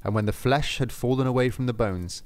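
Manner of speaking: plain reading voice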